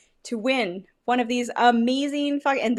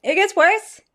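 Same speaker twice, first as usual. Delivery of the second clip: pained outburst; 9 semitones higher, 7 dB louder than usual. Real, not acted. Grimacing after the second sip.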